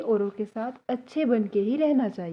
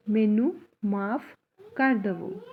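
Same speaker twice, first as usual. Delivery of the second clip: very slow, deliberate phrase; slow teaching demo; much slower than usual. Shown as a demonstration. Teacher slowly models the full Punjabi phrase. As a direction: draw the words out for the learner. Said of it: "तो पूरा sentence कैसे बोला जाएगा".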